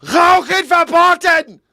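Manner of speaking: very high, loud, slow mock shout; German command. Host contrasting German sign with French, shouted command. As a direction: bark it out as a strict prohibition. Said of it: "And the German".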